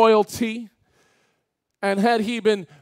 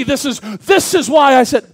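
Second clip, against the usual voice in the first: loud, raised voice of God the Father; thunderous voice; 6 semitones higher, 11 dB louder than usual. Retold or imitated. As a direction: boom it out like an imagined Father's answer. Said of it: "thunderous voice".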